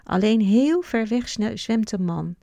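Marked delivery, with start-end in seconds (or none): none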